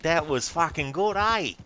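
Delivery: high, loud over-the-top Australian accent; exaggerated Australian accent. Shown as a demonstration. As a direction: ham up an Aussie bloke. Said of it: "I can do an exaggerated, uh, Australian accent".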